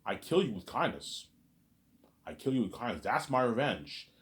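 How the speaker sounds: normal talking voice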